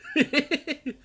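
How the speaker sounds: loud, high staccato giggle; gloating burst of laughter; gleeful cackle. Real, not acted. Player gloating over an easy win, high cackle.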